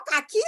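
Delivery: "kaki" said kah-kee, two syllables; wrong pronunciation. Shown as a demonstration. Teacher offers another misreading of cake, "kaki" with the E sounded as ee. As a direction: end "kaki" on a long ee. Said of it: "No, you won't do that".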